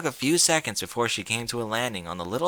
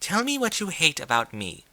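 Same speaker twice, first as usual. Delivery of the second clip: edge; 6 semitones higher than usual. Acted. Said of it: "Rarity's voice had an edge".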